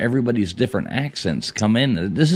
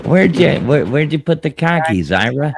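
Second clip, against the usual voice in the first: mock Boston accent; 3 semitones higher than usual. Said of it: "Boston, talking about car keys".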